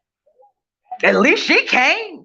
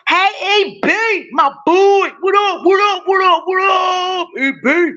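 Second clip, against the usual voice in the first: hyped greeting; 7 semitones higher than usual. Real, not acted. Hyped greeting to joining viewer.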